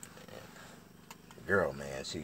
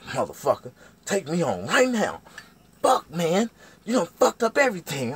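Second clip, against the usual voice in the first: high, loud cussing out; loud, high-pitched angry rant; mad girlfriend; 12 semitones higher, 12 dB louder than usual. Retold or imitated. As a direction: act out a furious woman demanding to leave. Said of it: "madder than a motherfucker".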